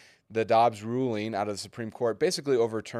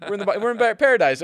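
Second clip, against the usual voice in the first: high and loud, gushing; excited; 12 semitones higher, 11 dB louder, faster than usual. Retold or imitated. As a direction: exclaim it with delight. Mock joy at paradise.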